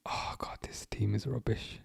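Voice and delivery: very soft, voiceless grumble; barely audible, fed-up aside; dejected whisper. Real, not acted. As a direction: sigh it out under your breath. Despairs at team after conceding.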